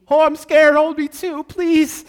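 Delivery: high, loud mock whimper; hammed-up terror. Preacher voicing a disciple begging to be held, loud mock panic. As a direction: ham up a frightened plea to be held. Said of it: "they're probably like".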